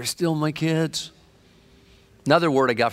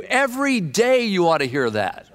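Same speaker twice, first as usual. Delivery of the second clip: usual speaking voice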